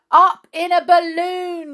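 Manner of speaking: loud, high group yell. A narrator's reading. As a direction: shout it all together. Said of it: "yelled everyone".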